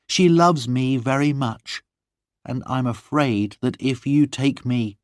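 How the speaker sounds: soothing John about her maid; Lorna pleading. Acted.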